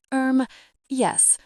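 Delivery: a startled, awkward yes; flustered. Acted. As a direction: caught off guard, high erm. Hesitant high answer.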